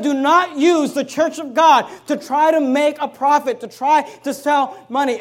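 slow, loud pulpit emphasis; loud preaching. Real, not acted. Pastor warning his church with loud, slow preaching. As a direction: lean into every word.

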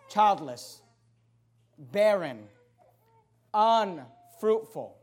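each word low and slow, with long pauses; solemn slow list. Retold or imitated. Preacher slowly lists barren labels. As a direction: let each label sink in, one by one. Labels Sarai would give herself.

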